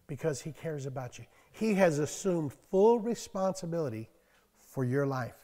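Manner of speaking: usual speaking voice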